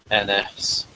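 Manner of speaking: ordinary talk, nothing marked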